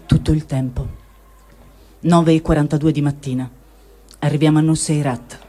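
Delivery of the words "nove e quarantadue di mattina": ordinary delivery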